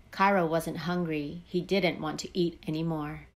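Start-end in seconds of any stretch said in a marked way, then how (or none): none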